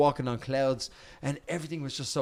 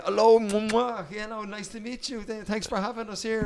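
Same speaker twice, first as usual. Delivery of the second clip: broken English; 5 semitones higher than usual. Retold or imitated. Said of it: "he hadn't got great English".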